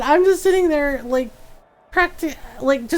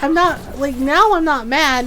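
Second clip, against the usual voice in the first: ordinary talk, nothing marked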